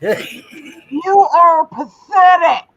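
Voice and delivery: slow, loud, drawn-out jeer; loud scorn. Real, not acted. Host telling trolls they are pathetic, slow loud scorn. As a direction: proclaim it slowly at full voice.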